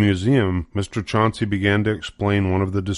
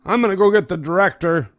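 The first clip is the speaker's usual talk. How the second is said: higher, fast voiced guard; hurried guard; 11 semitones higher, 3 dB louder, faster than usual. Guard rushing off to fetch the director, fast voice. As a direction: rush the line like a flustered guard.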